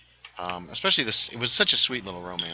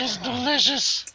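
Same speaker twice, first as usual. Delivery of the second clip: much higher, slow, gushing tipsy voice; drunk high voice; 14 semitones higher, slower than usual. Pretend. Praising the beer in a high, slow drunk voice. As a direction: savor it like a happy drunk. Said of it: "I start talking like this".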